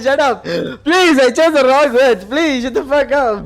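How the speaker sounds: loud joking yell at a friend; playful shout. Real, not acted. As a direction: yell it half laughing, mock outraged. Playful yelling at chat friend.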